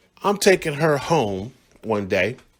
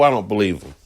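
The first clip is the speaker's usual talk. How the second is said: ordinary talk, nothing marked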